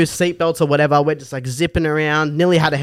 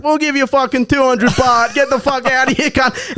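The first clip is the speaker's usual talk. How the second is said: high, aggressive yell, mock uncle; 10 semitones higher than usual. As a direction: send the driver packing. Uncles yelling at driver.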